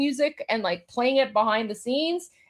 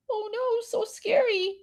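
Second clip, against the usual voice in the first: mock scared; 8 semitones higher, 5 dB quieter than usual. Mocking fear of drones.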